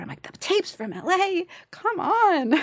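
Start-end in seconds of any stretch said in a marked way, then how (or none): 0.2-1.5 high, slow mock disbelief; hammed-up incredulity, pitched way up; incredulous echo. Incredulous repeat of the news. 1.7-2.5 high-pitched mock exasperation; acted-out pleading dismay; exasperated protest.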